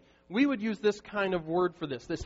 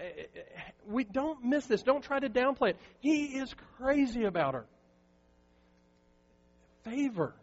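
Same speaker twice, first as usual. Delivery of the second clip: normal talking voice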